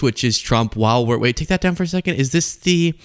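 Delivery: ordinary delivery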